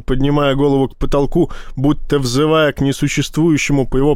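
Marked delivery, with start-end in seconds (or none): none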